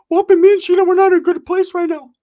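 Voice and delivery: high, loud, whiny cousin voice; Ricky's voice. Retold or imitated. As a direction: squeak it out like a worried goofball. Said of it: "Ricky's like".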